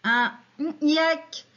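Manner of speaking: UN N, YEK with a hummed "mm" for the gap; missing syllable masked. Shown as a demonstration. Teacher quizzing children on a missing syllable, N hummed as placeholder. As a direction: hum N in place of the hidden syllable, then say YEK. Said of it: "Quelle est la syllabe manquante".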